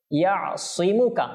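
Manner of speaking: slow ইয়াসিমুকা with a heavy Arabic S; heavy sad sound. Shown as a demonstration. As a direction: thicken the S in ইয়াসিমুকা to sad, not sin. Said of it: "এটা সদ". (ইয়াসিমুকা-তে সিন নয়, মোটা সদ)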